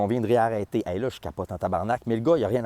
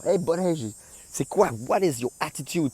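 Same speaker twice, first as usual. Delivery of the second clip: confronting; 6 semitones higher than usual. Retold or imitated. Confronting the electrician.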